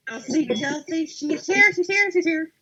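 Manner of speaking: high, loud excited squealing. Retold or imitated. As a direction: gush it like a giddy fan. Retold excited message to friend.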